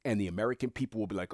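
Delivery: normal talking voice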